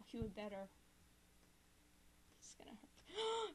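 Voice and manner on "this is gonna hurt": higher unvoiced whisper. Real, not acted. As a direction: whisper it in anticipation. Dread aside.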